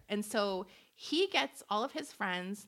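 ordinary delivery

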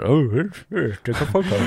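a hint of a jolly, bumbling politician; jolly Boris Johnson. Podcast guest retelling asking him for a photo, jolly impression. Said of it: "Was he quite jolly about it all".

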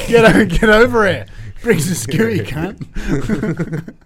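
loud, high ocker heckle; hammed-up Aussie yob; mock Aussie accent. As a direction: bark it like a rowdy Australian bloke.

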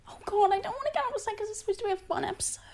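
breathless whisper, holding back crying; half-whispered, trying not to cry; a shaky whispered aside, fast; whispering through tears. Real, not acted.

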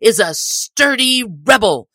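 high, loud furious orders; angry sheriff shout. A narrator's reading. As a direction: command the men in a rage. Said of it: "cried the sheriff".

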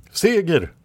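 high, ringing proclamation; victorious exclamation. A narrator's reading. Red Lady hails victory after the battle, high exclamation. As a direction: proclaim it high, falling at the end. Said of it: "utropade Röda Damen".

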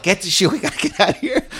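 high-pitched panicked urging, half laughing; retold panic. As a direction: act out a comic scramble to escape. Bolting from house, retold.

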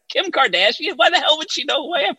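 fast, high-pitched mock disbelief; incredulous. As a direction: rattle it off in a squeal of astonishment. Replays his disbelief at the introduction.

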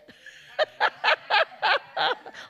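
high, loud burst of laughter. Real, not acted. Preacher laughing at Iris's retort, loud. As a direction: laugh out at the thought.

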